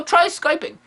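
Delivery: usual speaking voice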